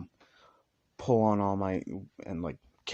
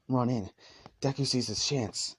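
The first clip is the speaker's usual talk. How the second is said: ordinary delivery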